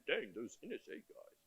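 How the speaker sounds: lightly put-on British accent, quiet; a hint of a British accent quoting a friend; mock British accent, muttered. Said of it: "he said it in a British accent".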